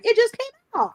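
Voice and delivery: high-pitched mock-naive quote; mimicking naive people. Host mimics people online discovering an old book, high mock voice.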